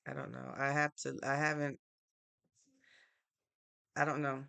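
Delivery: ordinary talk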